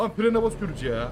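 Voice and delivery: furious complaint about the braking; angry outburst. Real, not acted. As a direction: snap at the driver who braked. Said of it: "Çok sinirlendim".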